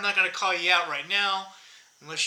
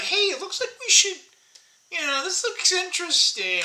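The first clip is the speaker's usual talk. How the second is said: curious self; 11 semitones higher than usual. Retold or imitated.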